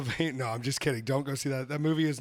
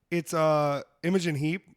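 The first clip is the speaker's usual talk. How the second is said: ordinary delivery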